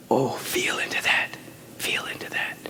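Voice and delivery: hushed, breathy prayer whisper; whispered affirmation. Acted. Preacher to congregation, whispering the affirmation. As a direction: draw them in with a soft whisper.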